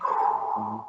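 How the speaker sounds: low breathy exhale of relief; breathy relieved whew. Retold or imitated. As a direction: blow out a big sigh of relief. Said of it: "and I'm like".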